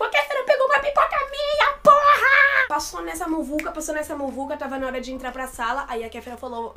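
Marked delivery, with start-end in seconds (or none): 0.0-2.7 very high, loud re-enacted squeal; starstruck outburst. Fan whose popcorn the star took, starstruck outburst. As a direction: shout it in overwhelmed fan joy.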